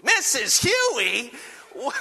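high, loud incredulous shriek; outraged wife. Retold or imitated. Wife learns where her husband's money went, outraged. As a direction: drag out the name in shrill disbelief, mimicking her. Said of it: "And she was mad".

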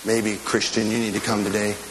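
normal talking voice

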